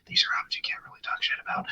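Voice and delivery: quick conspiratorial whisper, almost no voice; whispered aside. Real, not acted. Admitting a risky opinion to the cohost, quick whisper. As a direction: lean in and whisper it like a secret.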